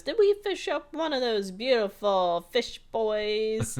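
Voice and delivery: loud, slow hearty fisherman; mock fisherman announcing the catch. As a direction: boom it out like an old salt. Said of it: "The fisherman goes".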